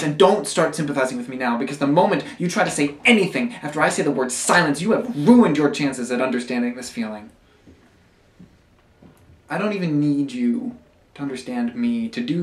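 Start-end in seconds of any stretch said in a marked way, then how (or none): 0.0-7.3 fast, louder, forbidding outburst; scolding rant. Acted. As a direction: cut off any sympathy, loudly and quickly. Scolds listener against sympathizing.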